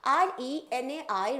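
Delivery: slow letter-by-letter spelling; spelled out. Shown as a demonstration. Teacher dictating the spelling slowly to students. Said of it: "spelling it letter by letter".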